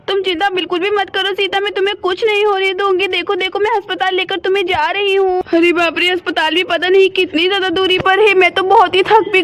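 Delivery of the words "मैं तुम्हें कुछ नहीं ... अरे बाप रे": normal talking voice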